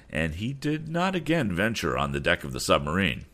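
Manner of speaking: ordinary delivery, nothing marked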